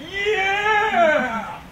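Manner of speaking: high, loud triumphant shout; loud high gamer cheer; victory yell. Retold or imitated. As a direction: whoop it up over a win.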